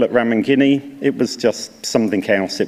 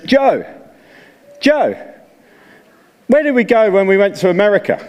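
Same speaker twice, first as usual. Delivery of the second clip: calling out; 8 semitones higher than usual. Retold or imitated. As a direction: shout Joe's name across to a mate. Elder calls across to Joe.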